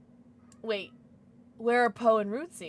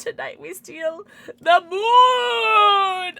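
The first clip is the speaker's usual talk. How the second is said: villain boss; 7 semitones higher, 8 dB louder, much slower than usual. Pretend. Minions line, villain boss voice.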